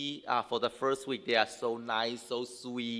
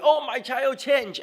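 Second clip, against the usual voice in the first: delighted; 10 semitones higher, 7 dB louder than usual. Retold or imitated. Quoting delighted parents.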